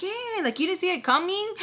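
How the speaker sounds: high, mock-incredulous comeback; high-pitched "well, obviously" tone. Retold incredulous reply to him.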